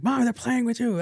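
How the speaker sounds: high, put-on daughter voice; imitated exasperated daughter. Daughter telling mom it's a joke, mock exasperated.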